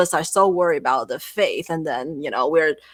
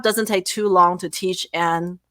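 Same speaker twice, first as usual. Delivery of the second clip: normal talking voice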